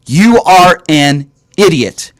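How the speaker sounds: slow, deliberate stress on each word of "You are an idiot"; emphatic insult. Real, not acted. Host repeats his verdict on cheating claimants, emphatic and slow.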